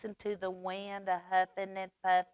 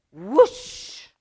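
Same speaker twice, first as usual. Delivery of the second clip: whooshing wind; 8 dB louder than usual. Acted. Wind sound effect.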